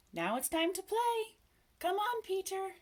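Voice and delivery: put-on high, excited children's call; shouting. A narrator's reading. Boys gathered round Peter, shouting to play.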